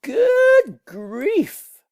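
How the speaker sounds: shrill mock exasperation; exasperated groan. A narrator's reading. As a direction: cry out in dismay. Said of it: "groaned the ones".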